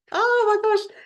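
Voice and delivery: high, loud burst of delight; thrilled by the cliffhanger; excited gasp. Real, not acted. A reader to her viewers, thrilled and squealing.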